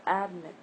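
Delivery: ADmit, AD punched and MIT dropped; stress on first syllable. Shown as a demonstration. Teacher offers learners the wrong option, ADmit stressed on the first part. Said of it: "not admit".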